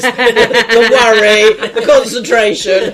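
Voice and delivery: loud, slow laughing. Real, not acted. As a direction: laugh through the words. Laughing at friend's face.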